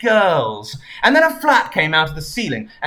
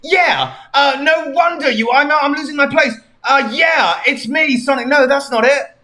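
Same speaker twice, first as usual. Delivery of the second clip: higher, loud, stumbling hero; Sonic voice; 8 semitones higher, 5 dB louder than usual. Acted. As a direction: shout it, then fumble. Sonic voice, loses place.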